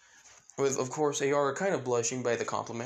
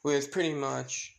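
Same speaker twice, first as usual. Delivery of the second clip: ordinary delivery, nothing marked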